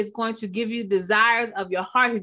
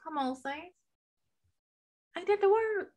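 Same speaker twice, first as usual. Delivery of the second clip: preacher call; 8 semitones higher, 8 dB quieter than usual. Acted. Preacher calling to congregation.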